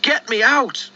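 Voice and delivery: high, slow panicked pleading; panicked choking. Acted. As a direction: cry out, choking on dust. Thomas choking in coal dust.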